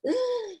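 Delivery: sharp high gasp for air; imitated gasp. Chaplain imitating a covid patient gasping, a sharp high intake. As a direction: mimic a patient straining to breathe.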